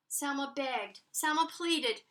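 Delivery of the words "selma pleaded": usual speaking voice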